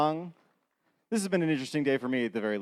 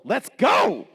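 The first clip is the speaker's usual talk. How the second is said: hype shout; 7 semitones higher, 5 dB louder than usual. Real, not acted. Emcee starting next dancer.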